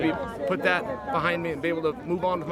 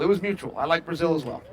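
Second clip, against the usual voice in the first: ordinary talk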